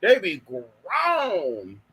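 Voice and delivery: "They be grown" stretched very slowly, every word long; long slow "grown" for effect; very drawn out. Shown as a demonstration. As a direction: drag the phrase out for emphasis. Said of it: "repeated slower and drawn out".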